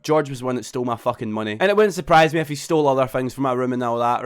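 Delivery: normal talking voice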